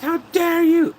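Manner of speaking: loud, high-pitched mock outrage; indignant outburst. Man making a scene at a wake, hammed-up indignation. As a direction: act out a scandalized scolding.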